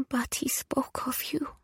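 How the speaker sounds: soft, high whisper; hushed Italian widow. A narrator's reading. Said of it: "she whispered".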